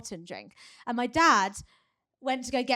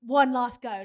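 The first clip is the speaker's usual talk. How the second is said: louder coach shout of encouragement; coach urging; 2 semitones higher, 7 dB louder, slower than usual. Retold or imitated. Coach urging athlete, louder pushing shout. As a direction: push him like a coach at the Olympics. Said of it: "his coach being like".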